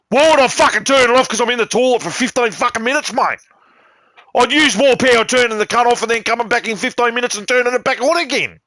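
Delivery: high-pitched exasperated rant; incredulous and fed up, pushing it out; heated rant. Real, not acted. Ranting against turning TV off.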